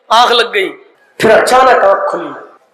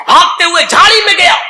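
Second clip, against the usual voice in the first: frantic rush; 7 semitones higher, faster than usual. A narrator's reading. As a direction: blurt it out at a run. Frantic running, shouted. (تیز گھبرائی ہوئی آواز)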